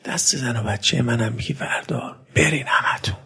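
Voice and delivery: unvoiced secret whisper; hushed night instruction to Nafe. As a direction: whisper it urgently in the dark.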